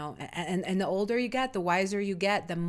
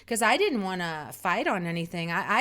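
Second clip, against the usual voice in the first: ordinary talk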